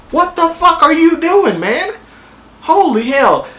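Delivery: high, loud disbelieving outburst; what are you even doing, a high outraged yell; exasperated outburst. Real, not acted. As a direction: demand an answer from a man you can't believe. Outraged at Stannis burning child.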